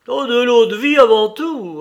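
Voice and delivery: very loud, high-pitched order; hearty, full-voiced command; big booming voice. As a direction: boom out the order with relish. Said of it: "ordonna Yashvin de sa grosse voix".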